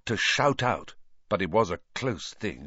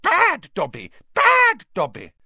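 high, loud elf scolding himself; furious self-punishing elf shout; 14 semitones higher, 9 dB louder than usual. A narrator's reading. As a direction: shriek it, stretching the first word.

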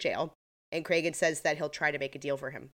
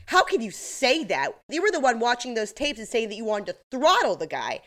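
indignant retort; 5 semitones higher, 4 dB louder than usual. Retold or imitated. Acted quarrel from recapped episode.

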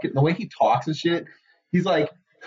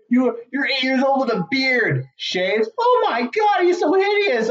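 mocking kids; 9 semitones higher, slower than usual. Voicing mocking classmates.

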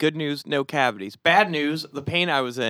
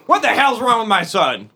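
loud, high angry father; angry dad; 7 semitones higher, 7 dB louder than usual. Pretend. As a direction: burst in as a furious dad. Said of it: "pretend to be your father".

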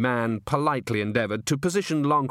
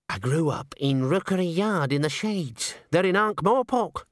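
slow, higher-pitched character voice; Henry's drawn-out, raised voice; Henry confessing; 7 semitones higher than usual. A narrator's reading. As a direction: own up to humble roots.

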